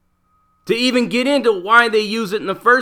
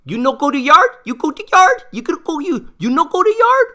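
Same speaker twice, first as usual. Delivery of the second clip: much higher, sing-song broken English, rising on "yard"; baby talk; 9 semitones higher than usual. Retold or imitated. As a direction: talk down to him like a toddler. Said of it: "like the, a three-year-old kid".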